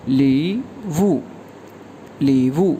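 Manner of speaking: ली वू stretched very slowly; model pronunciation. Shown as a demonstration. Teacher modelling the word ली वू, very slow. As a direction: say ली वू slowly, syllable by syllable. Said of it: "ये pronounce होगा ली वू".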